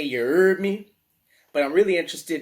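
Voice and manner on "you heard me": louder, higher New Orleans "You heard me?"; New Orleans slang. Shown as a demonstration. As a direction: toss it out like a proud New Orleans local. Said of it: "We also might say".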